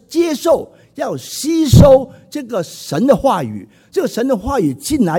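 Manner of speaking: preacher urging the church to take in God's word; preaching shout. Acted. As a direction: drive the call home with a shout. Preacher exhorts congregation.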